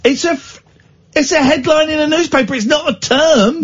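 high, exasperated protest. Real, not acted. Host scolds his co-host for a made-up term, high and exasperated. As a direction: throw up your hands, pitched high.

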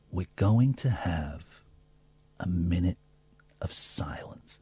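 slow, fading to a whisper; slow whisper announcing silence; hushed. Retold or imitated. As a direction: soften down to a whisper. Mister Rogers announces silence.

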